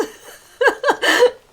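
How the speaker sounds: big, loud, high burst of laughter. Real, not acted. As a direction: let it burst out, real and loud. Laughing at her own swearing.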